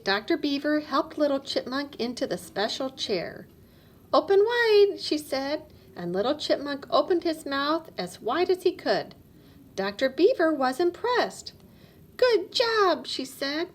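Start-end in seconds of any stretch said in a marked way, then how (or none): 4.1-5.0 bright, higher and louder dentist; cheerful dentist coaxing a child; lightly voiced kind dentist. A narrator's reading. Dentist voice in read story. 12.2-13.1 high, louder cheerful praise; a light, higher dentist voice. A narrator's reading.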